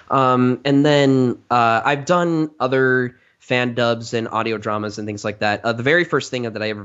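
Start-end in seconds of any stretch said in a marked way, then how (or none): none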